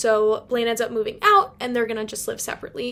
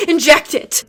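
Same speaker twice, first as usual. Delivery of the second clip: much louder, higher, pressed home; louder gush; 4 semitones higher, 11 dB louder than usual. Real, not acted. Fan repeating her verdict, louder excited gush. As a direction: repeat it with even more glee. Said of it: "like, I just".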